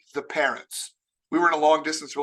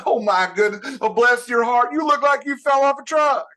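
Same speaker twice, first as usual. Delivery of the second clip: higher and louder syrupy Southern lady; sweet Southern insult; 5 semitones higher, 6 dB louder than usual. Retold or imitated. Southern lady blessing your heart before an insult, syrupy. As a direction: smile wide and say it sugary. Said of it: "It's said with a giant smile".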